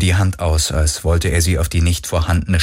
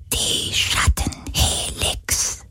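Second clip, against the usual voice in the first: very slow, soft whisper; knowing whisper; 6 dB quieter, much slower than usual. Acted. Sea ghost names the Shadow Helix to Galen, whispered. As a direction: breathe the name with understanding. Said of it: "flüsterte der Geist des Meeres verstehend".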